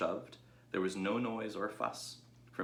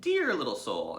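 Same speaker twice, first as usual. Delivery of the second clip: higher, louder doting ewes; fond sheep crying out; 6 semitones higher, 7 dB louder than usual. Acted. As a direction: coo over the little pig. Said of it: "they cried".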